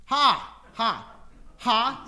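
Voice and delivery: loud staccato ha ha ha; demo laughter. Shown as a demonstration. Preacher shows the class how laughter sounds, loud and clipped. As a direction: bark out a laugh sound to show it. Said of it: "What are some of them?".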